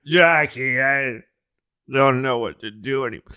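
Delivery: put-on a sad drunk confessing on the phone; drunk LBJ. Comic imitating drunk LBJ, mournful. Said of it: "he's like".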